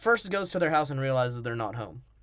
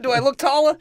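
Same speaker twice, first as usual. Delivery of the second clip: mock short guy; 11 semitones higher, 9 dB louder than usual. Voicing short Pesci.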